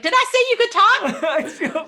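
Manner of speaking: high, loud mock parental scolding; shrill-pitched, hammed-up parent telling off; lecturing parent. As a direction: snap like an exasperated parent cutting off a child. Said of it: "when your parents are lecturing you".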